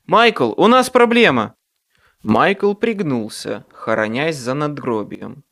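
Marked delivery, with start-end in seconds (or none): 0.0-1.5 loud, higher call across graveyard; shout. A narrator's reading. Harry calling to Michael for help, loud shout. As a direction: yell for Michael. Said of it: "крикнул я".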